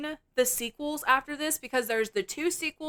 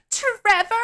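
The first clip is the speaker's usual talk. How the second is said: much higher, louder urgent shout of his name; alarmed call; 11 semitones higher, 7 dB louder than usual. Retold or imitated. Stacy hears screaming, loud high urgent call. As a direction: yell for him through the door.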